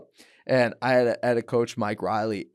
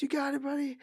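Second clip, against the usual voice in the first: high, softer coaching; soft coach advice; 14 semitones higher, 7 dB quieter, faster than usual. Retold or imitated. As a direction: coax him gently between rounds. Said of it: "he goes".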